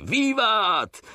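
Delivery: high drawn-out cheer, no extra volume; retold cheer. Soldiers cheering their commander, retold high-pitched cheer. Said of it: "my zaraz poczęli krzyczeć".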